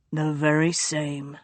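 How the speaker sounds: low, urgent hushed warning to a sister; delirious duke warning his sister, low and hushed; feverish duke. A narrator's reading. Feverish duke's voice.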